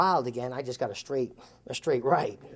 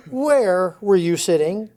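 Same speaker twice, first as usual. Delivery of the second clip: retold challenge; 7 semitones higher, 8 dB louder, slower than usual. Confronting the late helper.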